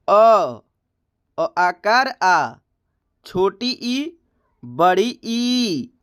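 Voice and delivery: each vowel said slowly and distinctly, with a pause after each; reciting Hindi vowels. Shown as a demonstration. Teacher reciting the vowels slowly for the students. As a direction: sound out every vowel one by one, drawn out. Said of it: "हम स्वर वर्ण का उच्चारण करते हैं".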